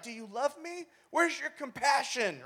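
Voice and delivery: high-pitched, pleading questions; mock hurt. Kid doubting a parent's love, mock hurt. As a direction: play the wounded child, stretching the last question. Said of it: "you're just frustrated about it".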